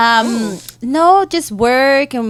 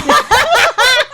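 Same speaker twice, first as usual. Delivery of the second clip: high laugh; 12 semitones higher, 3 dB louder than usual. Real, not acted. Big laugh over Angels joke.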